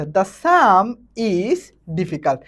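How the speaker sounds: very slow, each English word spaced out; slow dictation. Shown as a demonstration. Teacher dictating an example sentence to students, very slow. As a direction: read it out word by word for the class. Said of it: "teacher reads example sentence".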